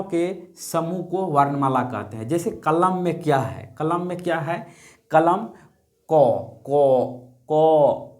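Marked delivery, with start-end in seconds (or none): 6.1-8.1 क said slowly on its own, with a clear short a at the end; teacher sounds out क for the class; sounding out a letter. Shown as a demonstration. Teacher shows students how क sounds. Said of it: "क जो हम बोलते हैं उसमें अ का उच्चारण".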